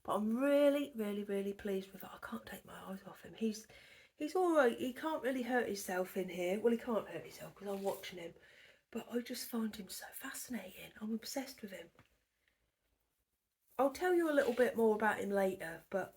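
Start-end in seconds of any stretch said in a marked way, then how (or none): 1.0-3.3 hushed whisper, a little quick. Real, not acted. Whispering while watching him. 8.9-11.9 soft hushed whisper. Real, not acted. Whispering about him.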